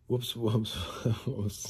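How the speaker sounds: put-on careless whoopsies, laughing; mock oopsy. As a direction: toss out a fake apology. Mocking the same excuse again.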